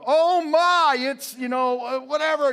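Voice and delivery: high, loud mock panic; panicking doctor. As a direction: play a doctor losing it.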